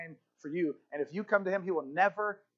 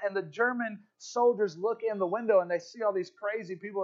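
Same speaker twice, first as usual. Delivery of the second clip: normal talking voice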